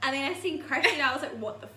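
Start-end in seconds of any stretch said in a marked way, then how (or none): 1.4-1.7 high-pitched mock shock; acted-out startled inner exclamation. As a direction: blurt it in laughing disbelief. Acted inner shock on seeing friend.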